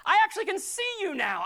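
high, loud cry to be noticed; dramatic insistence. Acted. Healed beggar insisting to doubting neighbours, dramatic high cry. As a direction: call out excitedly, voice pushed way up. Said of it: "No, it's dramatic".

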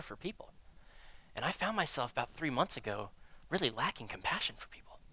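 hushed, breathy whisper; whispering an uncomfortable admission to the congregation; whispered confession. Real, not acted. Confessing lack of compassion.

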